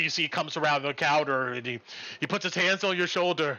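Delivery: normal talking voice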